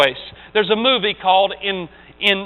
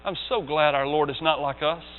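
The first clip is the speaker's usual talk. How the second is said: normal talking voice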